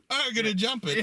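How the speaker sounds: high-pitched, playful put-on bravado; mock daredevil boast. Acts out biker about to jump.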